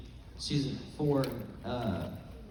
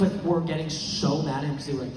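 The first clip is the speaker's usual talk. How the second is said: ordinary delivery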